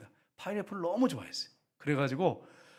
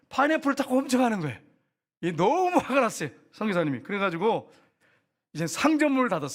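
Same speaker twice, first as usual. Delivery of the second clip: heated storytelling; 6 semitones higher, 5 dB louder than usual. A narrator's reading. Retelling missionary's anger again.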